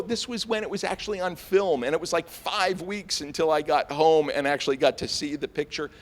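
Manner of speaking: ordinary talk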